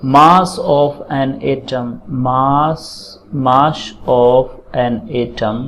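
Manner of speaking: slow, word-by-word dictation with long pauses. Acted. Teacher dictating atomic mass formula for students to write, slow dictation. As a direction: spell out the formula at note-taking pace, evenly.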